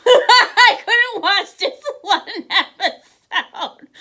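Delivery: laughing. Real, not acted. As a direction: crack up at your own confession. Laughing at her binge watching.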